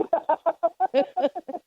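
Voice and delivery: high real laugh. Real, not acted. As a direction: laugh out, amused. Laughing while retelling.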